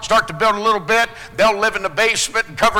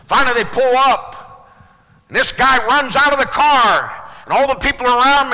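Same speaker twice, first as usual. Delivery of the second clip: ordinary talk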